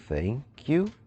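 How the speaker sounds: usual speaking voice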